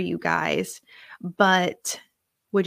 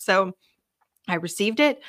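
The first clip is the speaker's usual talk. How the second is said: ordinary talk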